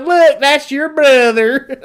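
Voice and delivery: high, loud, drawn-out Kentucky drawl; imitated Kentucky heckler; thick Kentucky accent. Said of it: "the way he said it".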